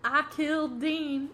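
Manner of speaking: slow Texas twang, stretching each word; Texas accent. Retold or imitated. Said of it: "that's his accent. Straight up".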